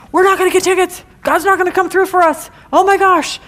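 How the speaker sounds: mock panic. Father and son losing faith.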